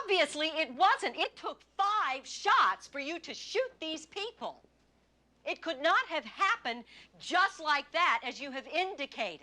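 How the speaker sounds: heated prosecutor overriding the witness; forceful cross-examination. Acted. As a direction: hammer the contradiction home. Lawyer hammers at witness's account.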